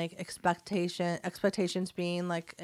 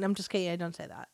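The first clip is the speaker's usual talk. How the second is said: ordinary delivery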